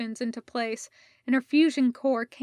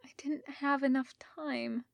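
miserable breathy whisper, fully unvoiced; 2 semitones higher, 7 dB quieter than usual. A narrator's reading. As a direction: breathe it out in despair, no voice at all. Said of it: "Rodney whispered miserably".

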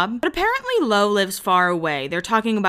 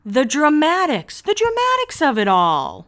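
mock dramatic; 7 semitones higher, 4 dB louder than usual. Real, not acted. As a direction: ham up the melodrama. Mocking the show's drama.

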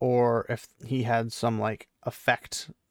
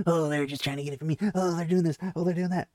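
mock paranoid; 5 semitones higher, faster than usual. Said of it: "you're acting worse than Matt did".

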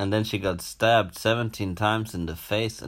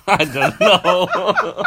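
high, slow laughing words; 10 semitones higher, 9 dB louder, slower than usual. Real, not acted. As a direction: giggle it out. Giggling at own joke.

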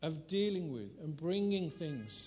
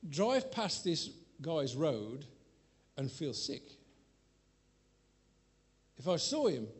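ordinary talk, nothing marked

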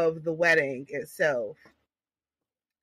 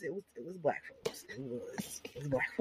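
hushed gossipy whisper; 5 semitones lower, 16 dB quieter than usual. Real, not acted. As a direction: drop to a secret whisper. Gossip lowered voice.

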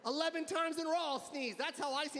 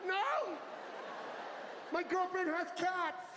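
panicked protest; 7 semitones higher than usual. Retold or imitated. A shopper recoiling from a sneezer, high frantic shout.

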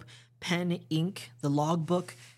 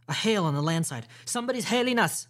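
raised, urgent lookout's call; alerting guard; 6 semitones higher, 4 dB louder than usual. Acted. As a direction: call out the news to those inside.

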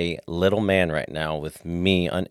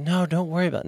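a hint of breezy wife, higher; imitating his wife; 9 semitones higher, faster than usual. Husband voicing his wife waving off the apology, higher. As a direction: brush it off lightly, pitched up. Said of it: "She's gonna be like".

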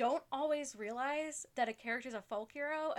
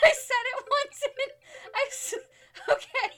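laughing; 14 dB louder than usual. Real, not acted. Cracking up mid sentence.

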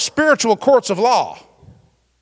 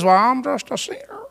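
whiny, softer imitation of a sinner; mock self-pity; 4 dB quieter than usual. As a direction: put on a pitiful, teary whine. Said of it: "when someone says".

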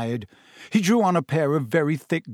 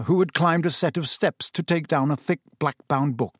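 plain reading voice

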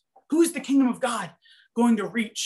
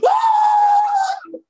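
piercing high shriek of strain; 7 dB louder than usual. Retold or imitated. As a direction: let out a desperate shriek. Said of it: "he's just shrieking".

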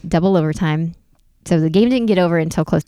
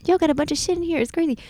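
much higher hyped party critter; party hamster voice; 10 semitones higher than usual. Retold or imitated. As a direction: voice the hamster bragging about the party.

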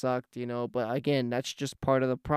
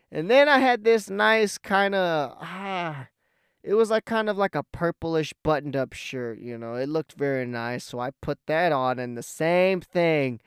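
usual speaking voice

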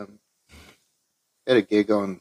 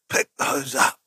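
louder, higher snap of "Pick those up"; yelled order; 6 semitones higher, 5 dB louder, slower than usual. Retold or imitated. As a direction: bark it at them suddenly. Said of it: "you just yell straight away".

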